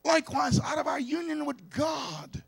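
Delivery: loud, climbing preacher's cry; fiery preaching. Acted. Preacher rousing the congregation about God's love, loud and fervent. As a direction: build the sermon to a rousing peak.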